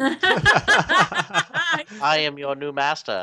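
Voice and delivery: slow, booming tyrant voice; booming arrogant alien overlord. Acted. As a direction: proclaim it like a cosmic ruler. Voicing the tablet as overlord.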